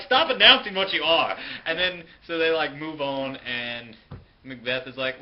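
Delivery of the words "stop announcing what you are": loud, fed-up shout; exasperated snap. Retold or imitated. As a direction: bark it at the pompous ghost. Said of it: "And they're like".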